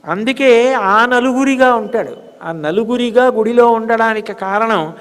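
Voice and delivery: high, sustained devotional pitch; impassioned, high-pitched exhortation; fervent preaching. Acted. Sermon rises to fervent pitch.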